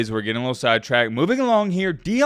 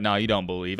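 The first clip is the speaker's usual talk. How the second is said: lightly voiced Coach Prime; 7 semitones lower, 5 dB quieter than usual. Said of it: "Coach Prime's like".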